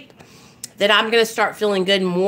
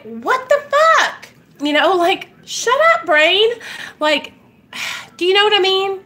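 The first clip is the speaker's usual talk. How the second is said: exasperated; 9 semitones higher than usual. Real, not acted. Snapping at her own thoughts.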